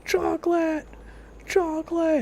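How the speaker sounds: high pitched mock cartoon scream. Gamer imitating the SpongeBob chocolate guy screaming. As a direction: yell it like the unhinged cartoon guy. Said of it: "the guy's screaming chocolate".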